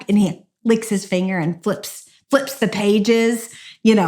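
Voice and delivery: ordinary delivery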